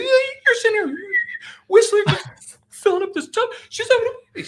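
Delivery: very high, loud mimicked hysteria; freaking out. Buddy scolding the man filling the tub, hysterical imitation. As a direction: wail it like a panicking friend. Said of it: "He's freaking out".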